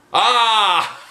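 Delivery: high whoop into a laugh; triumphant laugh; gleeful yell and laugh. A narrator's reading. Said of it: "yelled Mister Jack".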